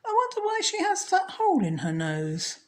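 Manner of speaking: high, put-on voice for the monster's puzzled owner; character voice. Narrator voicing the wife, high and puzzled. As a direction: wonder aloud in a high voice.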